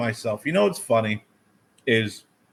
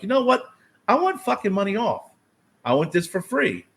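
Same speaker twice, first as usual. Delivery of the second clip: mock fed-up customer, "know" jumping much higher; irritated customer; 7 semitones higher than usual. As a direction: act out the customer losing patience. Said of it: "is gonna get irritated".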